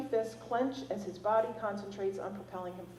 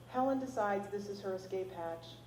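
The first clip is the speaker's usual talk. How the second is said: usual speaking voice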